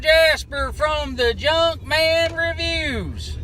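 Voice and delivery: loud, high, drawn-out greeting; big, loud show-host hello; host's intro. Acted. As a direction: belt out a show opener to the crowd.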